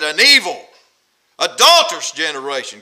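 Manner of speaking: loud, emphatic condemnation; forceful denouncing; angry rebuke. Acted. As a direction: hit the accusing words hard. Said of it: "really angry".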